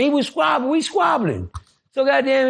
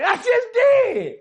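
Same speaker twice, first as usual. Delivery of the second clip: slow emphatic shout; much slower than usual. Retold or imitated. Picking an opponent to fight.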